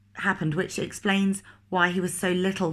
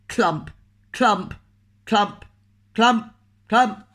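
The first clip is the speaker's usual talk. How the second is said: footsteps; 3 semitones higher, 6 dB louder, much slower than usual. Acted. Postman's footsteps sound effect.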